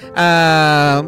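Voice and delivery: ordinary talk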